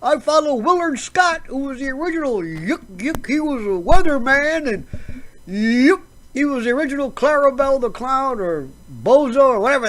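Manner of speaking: high, hammed-up goofy weatherman; mock the jolly TV weather guy, yucking it up; silly, high chuckling weatherman caricature; Al Roker impression. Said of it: "And you got Al Roker".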